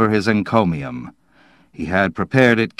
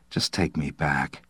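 lower, hushed, defeated plea; dejected; 5 semitones lower, 9 dB quieter than usual. A narrator's reading. Slade insisting to the operator, dejected. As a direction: repeat it softly, drained of fight.

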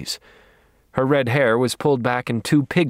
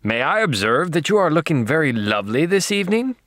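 higher-pitched, mock-lordly compliment; Lord Mountbatten; 5 semitones higher than usual. A narrator's reading. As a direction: play the gallant British lord. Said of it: "in my best Lord Mountbatten voice".

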